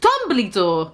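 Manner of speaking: ordinary delivery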